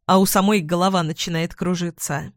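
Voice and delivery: plain reading voice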